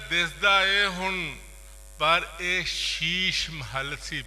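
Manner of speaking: projected verse recital with repeated half-lines; poetry recitation. Acted. Poet reciting a couplet to listeners, declaimed. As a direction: repeat and declaim, pausing between halves.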